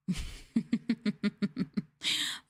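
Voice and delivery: breathy, gleeful little giggle; sadistic giggle. Acted. Looking forward to tormenting her fans, a sadistic giggle. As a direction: giggle like a villain savoring it. Promising to enjoy being sadistic.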